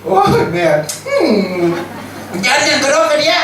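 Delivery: put-on a boy runs to his mother out of the dark; mock frightened cry. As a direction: squeal like a scared boy racing up the stairs. Frightened boy running back.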